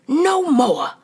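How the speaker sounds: high, loud stomping cry; angry protest. A narrator's reading. Fred stomping, furious at the newcomers. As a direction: put your foot down with your voice. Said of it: "Fred stomped his wispy foot".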